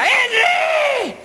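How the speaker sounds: high, drawn-out parrot screech; persistent parrot. Acted. Parrot still calling at night, screeching. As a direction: keep screeching it like a worn-out parrot. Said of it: "ya el loro afónico".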